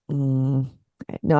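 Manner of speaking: ordinary talk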